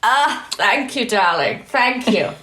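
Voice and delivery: slow, drawn-out mock-grand thanks; hammed-up "darling" voice; put-on luvvie voice. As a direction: play the gushing darling, stretching the words. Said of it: "That's my real voice, by the way".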